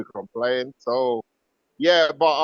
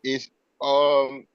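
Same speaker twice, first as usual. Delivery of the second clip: ordinary talk, nothing marked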